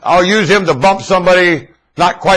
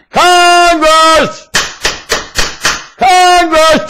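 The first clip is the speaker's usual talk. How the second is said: calling from far away; 11 semitones higher, much slower than usual. Acted. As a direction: call out as if to someone far off. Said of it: "I'm gonna shout over here".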